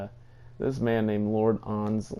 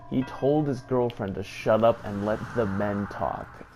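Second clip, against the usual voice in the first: normal talking voice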